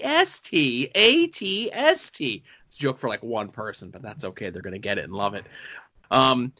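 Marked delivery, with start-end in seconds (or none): 0.0-2.4 "A T S T" slow and rising; sing-song AT-ST. Shown as a demonstration. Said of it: "you have to say it like that".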